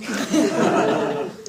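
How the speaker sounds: short embarrassed laugh. Real, not acted. Laughs after false confession.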